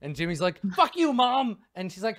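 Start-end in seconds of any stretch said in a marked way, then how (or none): 0.8-1.6 put-on high, loud kid shout; a rude boy screaming back; bratty kid yelling. Jimmy yelling back at his mom, high and loud.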